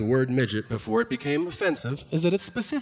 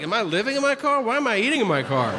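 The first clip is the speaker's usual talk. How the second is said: higher, rushed mock disbelief; mock indignant self-questioning; 8 semitones higher, faster than usual. Ashamed customer questioning life choices, mock indignant.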